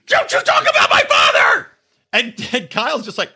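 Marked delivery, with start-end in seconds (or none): 0.1-1.7 very high-pitched mock screech; crazy outburst. Mocking Hannah flipping out at Kyle, crazy high screech. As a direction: screech it like someone suddenly snapping, hammed up. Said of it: "a crazy switch went off".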